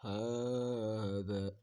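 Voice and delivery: ہاذا with the ہا held long and slow; ha stretched one beat. Shown as a demonstration. Teacher reading ہاذا slowly, ہا stretched. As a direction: tutor drawing out ہاذا for the student, slow and clear. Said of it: "ایک حرکات کے برابر کھینچیں گے".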